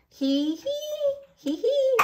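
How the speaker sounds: high happy hee hee; high giggling. Acted. George and Richard on the seesaw, high giggle. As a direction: giggle like a delighted toddler.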